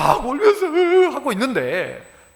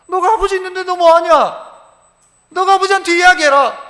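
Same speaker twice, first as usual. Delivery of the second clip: rising exhortation; 6 semitones higher, 5 dB louder than usual. Acted.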